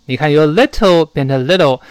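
normal talking voice

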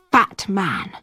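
usual speaking voice

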